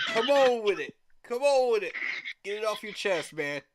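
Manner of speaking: loud, punchy goading shout; egging on. Retold or imitated. Commenter cheering on a roast, loud goading. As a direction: call it out like a hype man. Quoting his own egging-on.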